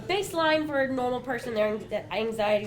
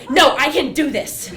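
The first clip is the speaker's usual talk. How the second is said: loud, laughing self-assurance; loud determined insistence; 3 semitones higher, 11 dB louder than usual. Retold or imitated. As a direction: insist you've got this. Insisting to scared couple.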